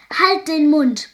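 loud, slow, high angry roar; brüllen. A narrator's reading. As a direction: bellow it to silence the servant. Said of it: "brüllte der Gutsherr". (wütend und laut gebrüllt)

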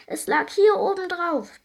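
high, slow shivery voice; shivering. A narrator's reading. Cold fairy explains to Bibo, shivering high voice. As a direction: shiver through the words. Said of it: "bibbert sie".

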